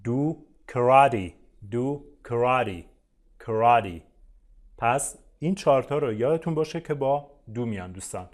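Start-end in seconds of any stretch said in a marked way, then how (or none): 0.0-4.0 slow, loud do karate; very slow model phrase; slow teacher demo. Shown as a demonstration. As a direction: model it slowly for learners.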